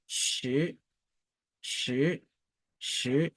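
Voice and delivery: "che" soft, gliding upward; measured rising "che" for learners; second tone. Shown as a demonstration. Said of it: "le deuxième ton".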